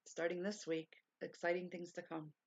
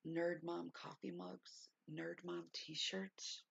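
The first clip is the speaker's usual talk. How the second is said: dreamy musing; 3 dB quieter, much slower than usual. Real, not acted. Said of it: "savouring the brand idea".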